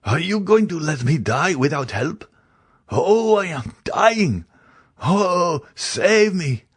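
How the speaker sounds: high, slow, desperate begging; slow, high, anguished cry for help; dying man's terrified plea. A narrator's reading. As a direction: plead for your life as you feel yourself dying. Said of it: "cried Barrois".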